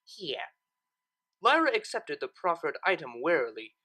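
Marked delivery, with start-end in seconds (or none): 0.0-0.5 curt. A narrator's reading. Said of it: "with a curt".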